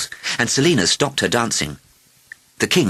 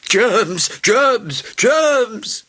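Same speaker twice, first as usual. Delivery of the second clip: high cries climbing each time; alarmed, high-pitched shouts; yelling doctors; 14 semitones higher, 3 dB louder, much slower than usual. A narrator's reading. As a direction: yell the warning word by word, rising in panic. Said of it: "yelled the doctors".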